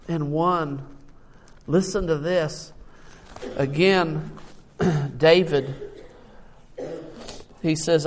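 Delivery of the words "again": ordinary talk, nothing marked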